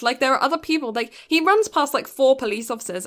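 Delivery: ordinary delivery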